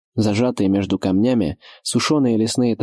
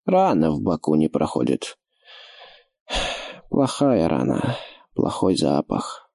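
put-on lisping old orc, lower and slow; slushy sh for s; 4 semitones lower than usual. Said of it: "чуть шепелявый голос".

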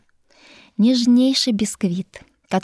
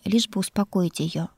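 plain reading voice

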